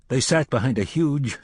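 usual speaking voice

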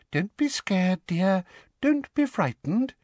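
high, cooing reassurance; sugary, soft coaxing; Umbridge, soft. A narrator's reading. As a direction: soothe a scared girl in a high little voice. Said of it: "said Professor Umbridge softly".

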